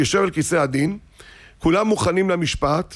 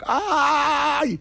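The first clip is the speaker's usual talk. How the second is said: long high "ay!" wail; child's cry of pain; 10 semitones higher, much slower than usual. Retold or imitated. Boy with finger caught in the door, long pained cry. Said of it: "התחיל לצעוק".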